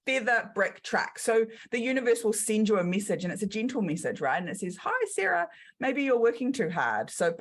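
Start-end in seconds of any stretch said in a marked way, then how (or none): 0.1-1.2 New Zealand accent. Shown as a demonstration. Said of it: "we squash all our vowels in New Zealand". 4.8-7.1 a much higher, light, kindly voice; gentle universe voice. Acted.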